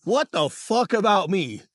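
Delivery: slow, loud mock outrage; half-acted disbelief, dragged out; outraged. Retold outrage at green screen.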